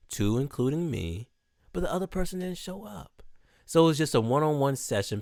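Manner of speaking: ordinary delivery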